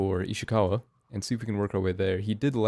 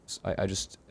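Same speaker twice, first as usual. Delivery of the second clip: usual speaking voice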